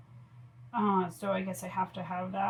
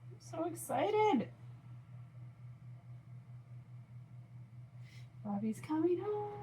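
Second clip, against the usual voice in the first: high, slow, thrilled; giddy with joy; excited; 9 semitones higher, 5 dB quieter than usual. Real, not acted. Partner coming home from Army.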